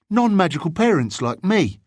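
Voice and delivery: put-on high-pitched boy explaining in earnest; serious boy. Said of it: "said the bigger boy seriously".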